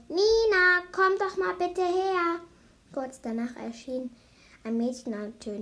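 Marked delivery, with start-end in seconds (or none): 0.1-2.4 loud, high, drawn-out call; yelling. A narrator's reading. Grandmother summoning Nina into the hallway, yelling. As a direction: holler down the hallway for someone. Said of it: "brüllte dann".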